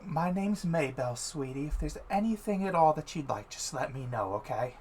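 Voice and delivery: put-on sweet old halfling woman. A narrator's reading. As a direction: coo it like a kindly grandma. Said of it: "and she says".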